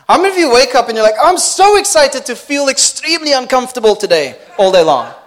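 loud, fast, laughing mock cheer; mock excitement. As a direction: ham up fake delight at suffering. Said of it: "mock excitement".

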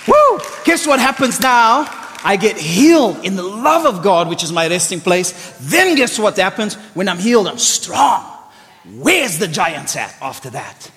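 loud, high, impassioned preaching; fired-up sermon voice; full-force preaching. Acted. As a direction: preach it out to the crowd.

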